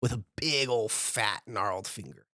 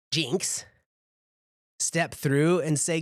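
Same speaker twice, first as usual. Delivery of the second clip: usual speaking voice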